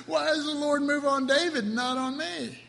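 high-pitched mock grievance; jealous complainer. Preacher voices people in David's time, complaining enviously. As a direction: protest it up high, as if slighted. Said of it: "we would go".

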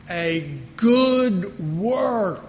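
a good work stretched out very slowly, good stressed; emphasis on good work. Shown as a demonstration. Pastor stressing scripture for the congregation, slow and weighty. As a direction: draw out good work so it sinks in. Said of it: "when I emphasize something".

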